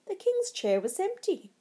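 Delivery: ordinary delivery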